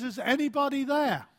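high, loud frightened call; scared shout for help; terrified. Acted. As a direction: call up to heaven in desperation. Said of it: "and he's terrified".

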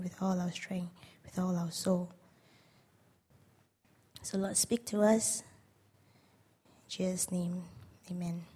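hushed, even-toned prayer. Real, not acted. Speaker leading church in thanks to the Father, quiet prayer. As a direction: pray aloud softly, with measured pauses.

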